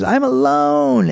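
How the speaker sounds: very high, drawn-out caricature; stretched-out high mock lament; mocking woman's wail. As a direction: wail it slowly in an awful high voice. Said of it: "her voice was just awful".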